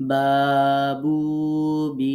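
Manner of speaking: "বাবু বি" very slow, each vowel held long; long drawn-out vowels. Shown as a demonstration. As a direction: stretch out every syllable of "বাবু বি" on a level note. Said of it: "এই যে একটু টানলাম, এরকম টেনে টেনে". ("বাবু বি" টেনে টেনে উচ্চারণ)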